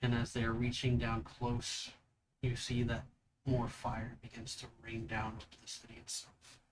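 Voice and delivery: GM scene narration. Acted. GM describes the vision.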